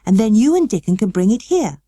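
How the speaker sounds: lightly voiced boy, commanding; a hint of a bossy young master; Colin giving orders. A narrator's reading. Colin telling Mary to fetch the tea, lightly commanding. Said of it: "he said".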